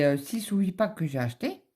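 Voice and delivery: usual speaking voice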